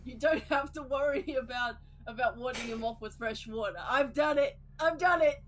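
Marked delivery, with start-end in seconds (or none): 3.9-5.4 triumphant shout. Pretend.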